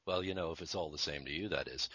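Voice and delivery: lightly voiced [name] in a Liverpool accent; [name] impression. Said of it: "I even got down to John".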